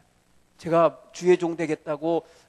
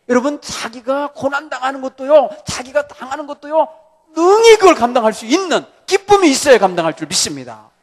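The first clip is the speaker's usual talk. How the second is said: loud, rising sermon exhortation; full-voiced pulpit preaching; fervent preaching; 7 semitones higher, 11 dB louder than usual. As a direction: press the congregation hard.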